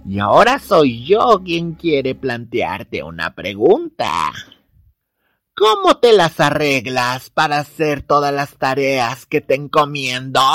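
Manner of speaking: put-on slow, high old witch; menacing hag, drawn out slowly. Baba Yaga speaks to girl.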